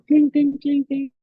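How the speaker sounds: high-pitched dinging bells; imitated bell jingle. Thief hearing the herd approach, imitated high bell sounds. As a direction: chime it high like little cow bells.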